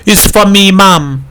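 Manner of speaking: a friendly shout across the house. A narrator's reading. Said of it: "Titus Hoyt shouted".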